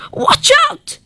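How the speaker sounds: high alarmed cry; dentist shrieks. A narrator's reading. Dentist warns the lady, high alarmed shriek. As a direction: shriek a warning. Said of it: "the dentist shrieked".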